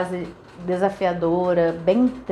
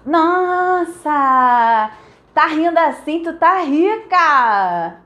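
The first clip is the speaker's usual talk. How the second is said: mocking joke; 6 semitones higher, 5 dB louder, much slower than usual. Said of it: "as piadinhas eram essa".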